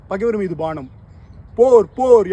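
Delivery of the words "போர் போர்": loud, high, like a beating drum; booming war cry. Acted. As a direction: boom it out like war drums. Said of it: "என முரசொலி முழங்கிற்று". (முரசு போல முழங்கும் குரல்)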